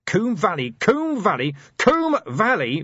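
furious, rising roar, slowing at the end. A narrator's reading. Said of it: "roared Vimes".

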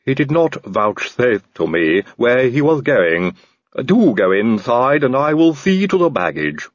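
slow, polite servant voice with a different timbre; a courteous, slightly sinister butler; Igor the servant. A narrator's reading.

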